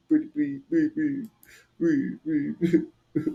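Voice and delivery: pleading with a high break on one please; mock whimper of a scared man; lightly voiced terrified plea; imitating the begging. Shown as a demonstration. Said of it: "This is how they sound".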